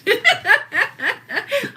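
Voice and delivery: high staccato cackle; laughter. Real, not acted. Delighted by her own accent, high cackle. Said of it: "I hate that laugh".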